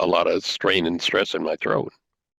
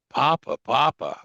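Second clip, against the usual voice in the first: eager, higher Papa, Papa; Papa, Papa called out with joy; enthusiastic; 7 semitones higher than usual. Retold or imitated. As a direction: call Papa, Papa like a delighted child. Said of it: "said with enthusiasm".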